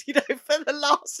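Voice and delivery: breaks into a laugh, pitch jumping up; laughing while recalling the late customer. Real, not acted. Laughing at old memory.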